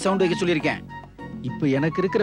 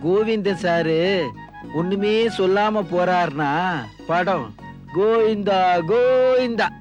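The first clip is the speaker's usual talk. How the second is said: mock lament; 7 semitones higher, slower than usual. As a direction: draw it out into a mocking Govinda chant. Mock chant that film is doomed.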